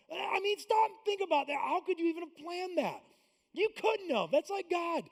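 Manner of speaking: high and loud, amazed sermon climax; fiery preaching. Real, not acted. Pastor marveling at the rescue before the congregation, loud and high.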